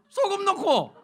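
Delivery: high, loud, offhand mom's answer; mock mother. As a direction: fling the answer like it's obvious. 어머니 대답 흉내. (당연하다는 듯 큰 소리로 대답하는 어머니)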